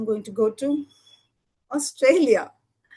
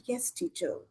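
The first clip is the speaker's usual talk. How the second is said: soft, dropped, deflated reply; very sadly; 3 semitones lower, 7 dB quieter than usual. Acted. Alex answers the teacher after a scolding, sad and much softer. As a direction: mumble it low like a scolded kid. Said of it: "Very sadly".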